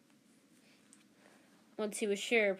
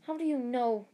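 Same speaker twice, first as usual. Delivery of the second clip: sputtering, higher and louder in shock; spluttering; 5 semitones higher, 5 dB louder than usual. Acted. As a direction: blurt it out, caught off guard. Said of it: "she spluttered".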